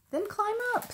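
high-pitched storybook taunt; teasing dare. A narrator's reading. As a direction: dare him in a high little voice. Millie dares Fox from tree.

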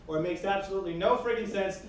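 ordinary delivery

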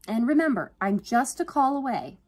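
high, loud, caring send-off; cheery reminder. A narrator's reading. Yvonne leaving Alex after a hug, cheery. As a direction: chirp a parting reassurance.

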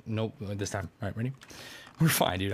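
usual speaking voice

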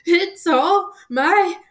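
loud, high, drawn-out acted wail; hammed-up tearful outburst; sobbing. A narrator's reading. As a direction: wail it slowly, full of self-blame. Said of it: "he sobbed".

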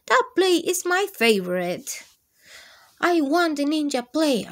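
put-on a boy demanding the ninja plate; loud childish demand. A narrator's reading. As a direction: demand it like a cross little boy. Danny wants his plate.

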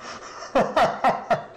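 breathy high laugh; high wheezing laugh. Real, not acted. Laughing at the clip.

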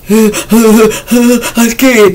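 high-pitched fake whimper; hammed-up tearful fear; mock scared. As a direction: imitate someone whimpering in fright. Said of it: "They're scared because".

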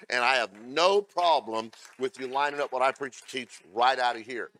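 loud, ringing pulpit delivery; full-force preaching. Acted. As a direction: preach it out hard to the congregation.

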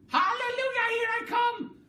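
very high, loud cackling crone voice; a mad old woman crowing in triumph; Grandma crowing as she grows, shrill glee; wild old grandma. A narrator's reading. Grandma character voice.

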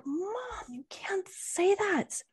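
breathy urgent whisper, slowed down; hushed whisper. Retold or imitated. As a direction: whisper it like a kid hissing at her mom in public. Kid scolding mom in retold scene.

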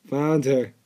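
normal talking voice

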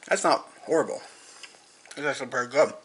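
talking with mouth full; words round a bite of food. Real, not acted. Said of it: "talking with my mouth full".

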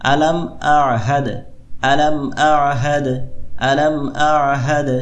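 slow, bouncing echo on the দ of আহাদ; teacher drills the qalqala in আলাম আহাদ; qalqala bounce. Shown as a demonstration. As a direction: let the দ in আহাদ bounce, slowly. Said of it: "এভাবে".

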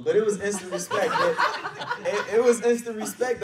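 loud, high, through laughter; amused insistence. Real, not acted. Trainee telling the audience about respecting his mentor, loud and laughing.

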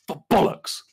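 high British exclamation; mock shout in a British accent; British swearing. Shown as a demonstration. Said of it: "British accents shouting British swear words".

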